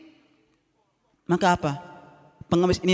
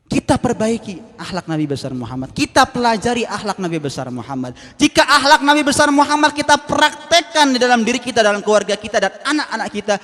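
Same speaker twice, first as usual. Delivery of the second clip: ordinary talk, nothing marked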